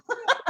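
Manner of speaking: short high laugh. Real, not acted. Guest ending her emotional introduction, laughing. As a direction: release the tension in a quick giggle.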